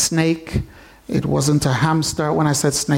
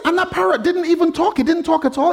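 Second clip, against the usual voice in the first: way up high, still disbelieving; high incredulous; 12 semitones higher, faster than usual. Retold or imitated. Owner amazed the parrot never talked, very high. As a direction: push it up in disbelief. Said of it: "He said".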